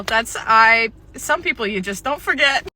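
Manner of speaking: ordinary talk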